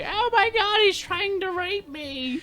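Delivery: put-on very high, slow, panicked old woman voice; hammed-up false accusation; shrieking old lady. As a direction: friend imitating the scooter lady accusing a helper. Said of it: "she's like".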